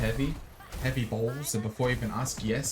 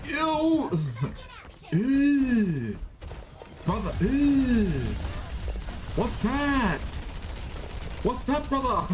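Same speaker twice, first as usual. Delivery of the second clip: disgusted squeal; 11 semitones higher, much slower than usual. Pretend.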